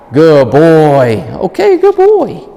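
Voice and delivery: high, loud slow praise; excited praise. Real, not acted. Trainer praising the dog after a tug, high and excited. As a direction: gush over the dog.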